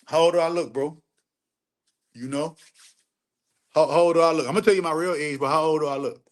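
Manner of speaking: ordinary talk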